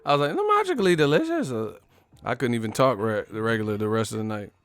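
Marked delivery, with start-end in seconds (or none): 0.3-1.5 much higher mock Irish leprechaun. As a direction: ham up a cartoon leprechaun.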